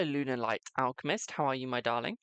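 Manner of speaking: ordinary talk, nothing marked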